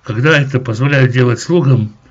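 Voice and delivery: normal talking voice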